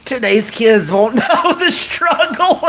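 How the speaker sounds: slow words breaking into high laughter; crying laughing. Real, not acted. Streamer reading a viewer's joke, cracking up midway. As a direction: let the sentence collapse into a real laugh. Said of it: "I'm crying laughing".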